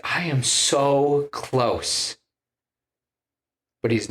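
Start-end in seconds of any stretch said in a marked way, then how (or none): 0.0-2.2 slow tense villain. Retold or imitated. Gorr at the door, tense.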